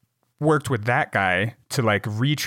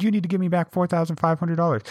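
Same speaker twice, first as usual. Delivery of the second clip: higher, put-on scammer voice; mock scammer demand; 7 semitones higher, faster than usual. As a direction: press the victim for the money. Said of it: "It's like".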